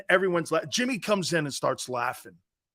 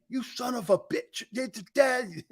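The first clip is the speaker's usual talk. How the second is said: higher, mock scream held back in volume; Buddy Ryan; 5 semitones higher than usual. Coach threatening a player, mock screaming. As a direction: imitate a raging coach without the volume. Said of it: "Buddy Ryan would scream at you".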